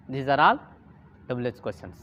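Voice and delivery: ordinary delivery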